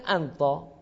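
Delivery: انطا with a still n in place of ayn; Estenta dialect. Shown as a demonstration. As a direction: say انطا, an n sound before the ta. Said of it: "حرف عین رو به نون ساکن". (انطا با نون ساکن به جای عین)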